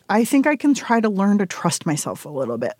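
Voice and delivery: normal talking voice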